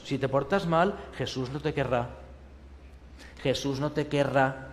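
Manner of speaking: put-on soft, ominous child warning; mock scolding. Adult threatening a child about Jesus, soft and ominous. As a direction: wag a finger at a child.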